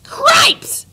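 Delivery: high squeaky cry; squeaky mouse exclaim. Acted. Furlow sees his brother with the princess, high squeaky cry. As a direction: yelp it like a startled mouse. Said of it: "said Furlow".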